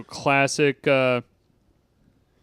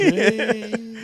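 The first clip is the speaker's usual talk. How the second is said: higher, laughing mock voice; laughing tease; 8 semitones higher than usual. Repeating the tease while laughing, higher. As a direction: laugh it out teasingly.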